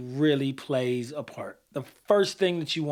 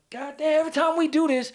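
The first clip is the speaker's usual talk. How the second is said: much higher, imitating a fed-up groan of complaint; exasperated outburst; 11 semitones higher than usual. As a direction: throw up your hands, mock exasperation. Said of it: "react and like".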